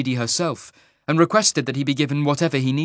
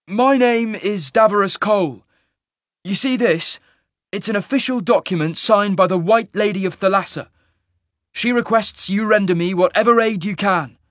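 higher, louder hero's voice; Cole, formal and insistent; 10 semitones higher, 6 dB louder than usual. Acted. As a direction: declare your business with authority. Hero announces himself in dialogue.

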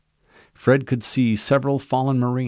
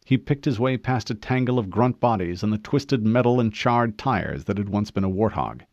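plain reading voice

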